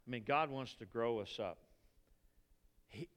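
usual speaking voice

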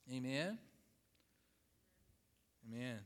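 ordinary talk, nothing marked

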